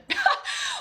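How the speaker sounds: a very high squealing laugh. Real, not acted. Laughs about her dead spark.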